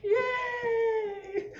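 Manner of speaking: put-on very high, squealing kid cheer; cheering children. Children cheering for Mao in the sketch, squealed high. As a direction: cheer like a crowd of small kids.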